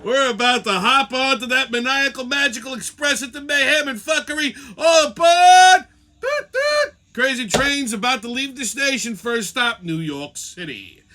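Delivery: hammed-up conductor announcing departure; booming pretend train conductor hollering to the kids. Playing a train conductor calling out.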